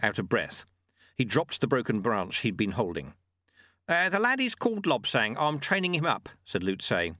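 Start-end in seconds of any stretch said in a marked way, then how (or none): 3.9-6.3 put-on higher, lively old monk; small monk voice. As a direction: call out like a spry little monk. Lutze introduces Lobsang.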